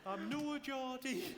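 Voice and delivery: high, drawn-out mock Geordie; hammed-up slow Geordie accent; terrible Geordie accent. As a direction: stretch out a bad Geordie protest. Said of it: "It's a terrible accent".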